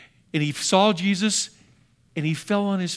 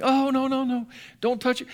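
high, alarmed mock protest; alarmed crowd; 8 semitones higher than usual. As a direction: pull back in mock horror, much higher. Crowd recoils from the leper.

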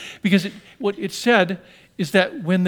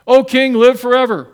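calling out; 6 semitones higher, 11 dB louder than usual. Acted. Daniel calling up to the king from the den, loud. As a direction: proclaim it up out of the pit.

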